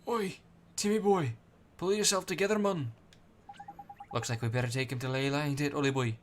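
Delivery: loud, cheeky Welsh sidekick; Welsh Drippy voice. Acted. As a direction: bellow it like a brash little Welsh fairy.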